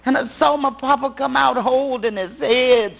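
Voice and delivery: ordinary delivery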